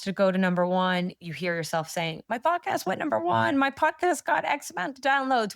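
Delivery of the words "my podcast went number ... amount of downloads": excited boast. Pretend. Imagined self announcing success.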